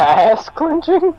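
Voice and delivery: very high, drawn-out question; incredulous squeal. Real, not acted. Player echoes the game master's odd phrase, high incredulous squeal. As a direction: squeak it back in disbelief, slowly.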